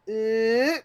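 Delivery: high, drawn-out "eh"; mock stretching noise. Mocking how developers stretch out a map, high drawn-out noise. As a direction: imitate dragging land out with a rising noise. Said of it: "just stretch out your little bit of land".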